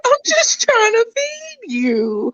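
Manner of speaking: high, slow, whiny plea; mock pleading. Mom pleading with her baby to feed, high slow mock whine. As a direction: whimper it like a rejected mom.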